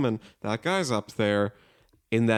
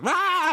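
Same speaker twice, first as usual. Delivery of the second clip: sudden high-pitched "ah" shriek; mock scream. Large Marge looks at him, sudden shriek. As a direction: imitate a film ghost's jump scare. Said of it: "she looks at him and she goes".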